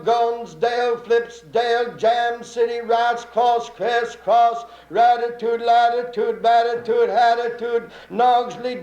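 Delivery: steady, sing-song train call; announcer reading the departures; station announcer. Acted. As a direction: rattle off the stops like a depot caller.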